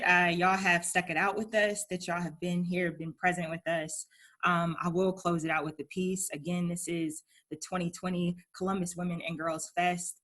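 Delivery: ordinary talk, nothing marked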